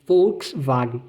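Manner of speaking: Volkswagen said fouksvagen, W as V; German Volkswagen. Shown as a demonstration. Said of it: "o melhor exemplo pra você treinar".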